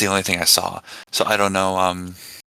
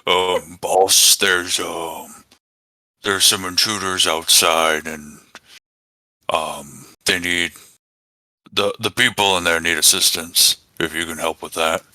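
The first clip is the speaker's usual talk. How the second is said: hobgoblin impression; 2 semitones lower than usual. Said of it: "try to do his best hobgoblin impression".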